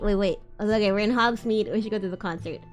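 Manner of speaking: normal talking voice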